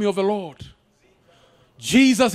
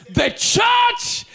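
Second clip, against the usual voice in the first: shouted, high preaching with music; fiery preaching; 6 semitones higher, slower than usual. Acted. Preacher shouting over the church band.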